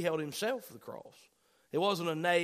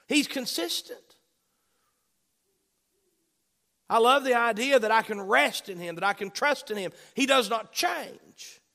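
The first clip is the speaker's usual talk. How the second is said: louder, higher full-force preaching, building the point; fervent preaching; 3 semitones higher, 5 dB louder than usual. Acted. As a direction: preach it out to the congregation with rising fire.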